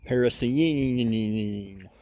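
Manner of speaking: very slow, stretched last syllable; drawn-out relish. Real, not acted. Junkyard explorer greets a car find, drawn-out relish. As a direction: draw the name right out. Savoring a car name he spots.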